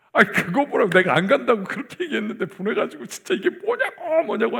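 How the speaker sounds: acted outburst, high-pitched exasperated griping; resentful complaint. Preacher as Moses griping to God about being sent, high exasperated complaint. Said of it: "원망, 불평, 막 진짜 막".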